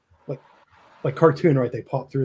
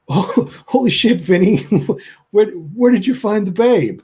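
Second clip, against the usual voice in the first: higher, slow, drawn-out astonishment; amazed doorman; 7 semitones higher, 3 dB louder than usual. Acted. Doorman gapes at Vinny's famous guest, astonished. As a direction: play floored at a celebrity on the doorstep. Doorman stunned to see the Babe.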